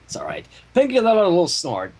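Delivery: ordinary delivery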